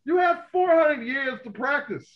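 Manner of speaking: high and loud, sarcastic jab; exasperated rant. Real, not acted. Ranting to his co-host about racism, pitched high and loud. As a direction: throw it at them like an accusation.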